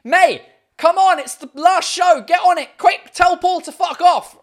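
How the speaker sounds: loud, high pleading yell at mum; high, insistent shouting; urging shout. Acted. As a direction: holler it out as a mock crowd demand. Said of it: "just be like".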